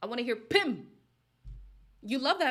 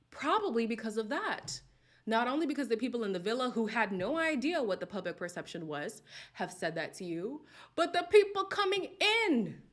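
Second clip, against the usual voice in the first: usual speaking voice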